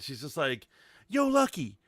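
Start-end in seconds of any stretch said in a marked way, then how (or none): 1.1-1.8 lightly imitated Southern accent, pitched up; a higher, cute Southern US lilt. Host quoting a church friend from Alabama, cute Southern accent. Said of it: "in that cute Southern accent".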